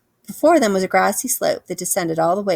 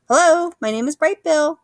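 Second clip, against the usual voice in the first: high, loud little gosling; proud little gosling introducing himself; 9 semitones higher, 5 dB louder than usual. A narrator's reading. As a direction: chirp it out like an eager baby goose. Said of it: "say to whoever was there".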